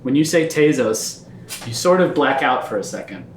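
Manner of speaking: low, hushed storyteller voice; low narration of a grim flashback; dark vision narration. A narrator's reading. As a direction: draw them into the memory.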